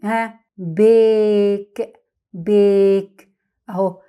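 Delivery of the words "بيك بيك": بيك with a long drawn-out "ay" vowel; long A by its name. Shown as a demonstration. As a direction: say بيك slowly, stretching the vowel like the letter name A. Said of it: "اقراها كده باسم ال A".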